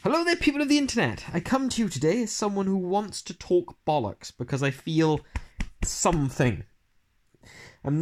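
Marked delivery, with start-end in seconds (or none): 0.0-2.7 bright, quick, high greeting; newsletter greeting voice. Shown as a demonstration. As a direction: read the opening with a lift. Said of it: "So it's called Switching from One Obsession to Another".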